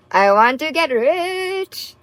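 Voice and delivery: slow, high, drawn-out joke; mock-greedy singsong; playful confession. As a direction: stretch it out gleefully, pitch climbing to the end. Joke confession, laughs, then takes back.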